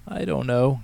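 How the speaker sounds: high, drawn moan of embarrassment; mild exasperated whine. A narrator's reading. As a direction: moan it at Dad, mortified. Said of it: "Katie moaned".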